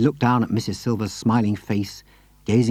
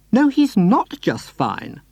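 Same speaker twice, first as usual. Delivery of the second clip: high, slow, insistent lady's protest; crying out in protest; 10 semitones higher, 4 dB louder, slower than usual. A narrator's reading. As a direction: plead that he is not fine. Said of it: "Missus Silver cried".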